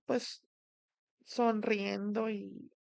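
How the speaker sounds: usual speaking voice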